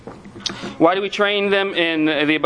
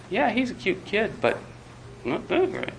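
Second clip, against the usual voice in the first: unimpressed onlooker; 5 semitones higher, 8 dB quieter than usual. Retold or imitated. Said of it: "everybody else says".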